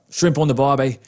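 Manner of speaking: practice-run Aussie accent; Australian accent. Shown as a demonstration. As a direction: warm up a mock Australian accent. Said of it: "I gotta be talking about".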